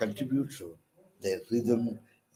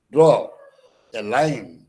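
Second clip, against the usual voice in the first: normal talking voice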